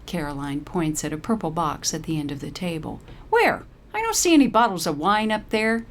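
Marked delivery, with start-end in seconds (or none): 3.3-5.9 higher, louder impatient disbelief; can barely hide the impatience. A narrator's reading. Paralee scans the table for wine, impatient and louder. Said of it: "could hardly disguise her impatience".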